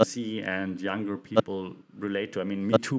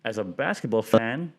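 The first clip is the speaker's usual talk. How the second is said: ordinary talk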